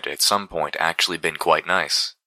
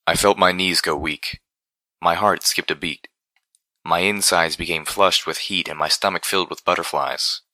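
ordinary delivery

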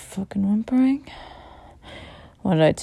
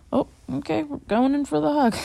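playful; 7 semitones higher, 4 dB louder than usual. Real, not acted. Playful shift in aftercare.

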